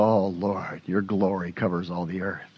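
soft, low, awed murmur to God; a hushed, reverent prayer on waking; hushed morning prayer. Retold or imitated. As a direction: breathe it out in quiet wonder. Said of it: "I woke up this morning and I go".

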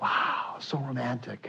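very soft, half-whispered swoon; hushed mock awe. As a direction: imitate a dreamy moviegoer under your breath.

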